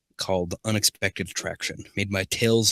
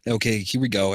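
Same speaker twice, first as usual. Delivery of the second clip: ordinary delivery, nothing marked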